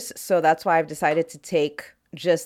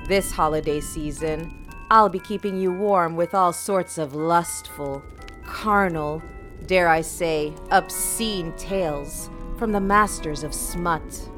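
sultry intro read. Acted. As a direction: purr it like a naughty invitation. Seductive podcast intro over music.

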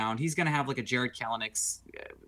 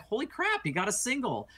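mock surprise; 8 semitones higher than usual. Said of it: "and I was like".

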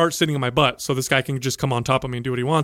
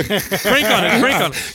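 laughing mock coach; 5 semitones higher, slower than usual.

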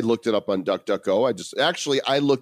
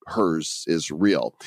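ordinary talk, nothing marked

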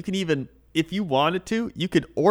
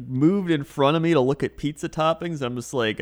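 normal talking voice

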